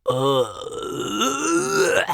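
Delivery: drawn-out revolted groan; disgusted groan. Real, not acted. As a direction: groan it out, rising as it goes. Disgust at naming the host.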